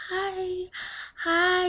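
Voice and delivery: put-on high, tender baby-talk hello; high-pitched new-mom greeting; cooing to newborn. Retold first greeting to her newborn.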